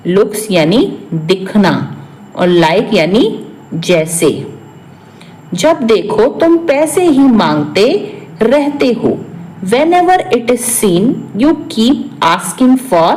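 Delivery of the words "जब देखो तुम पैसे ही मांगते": ordinary talk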